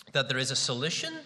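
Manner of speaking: SOLUTION lifted at the end like a question; questioning rise. Shown as a demonstration. Preacher showing the congregation a question mark, questioning rise on SOLUTION. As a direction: let your pitch climb on the end of "solution". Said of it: "you have to go up at the end".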